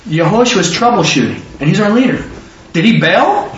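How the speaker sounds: usual speaking voice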